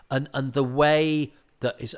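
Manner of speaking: ordinary talk, nothing marked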